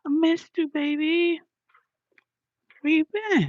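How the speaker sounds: very high cooing. Real, not acted. Asking Dex about the absence, high cooing baby talk. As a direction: coo it in a sustained high pitch.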